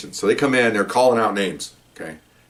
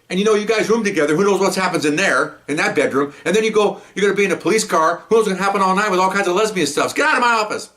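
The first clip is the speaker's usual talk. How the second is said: high, agitated mock tirade; retold angry rant; 10 semitones higher than usual. Sergeant throwing officers out of his office, high mock rant. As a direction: imitate a boss ranting, pitched high throughout.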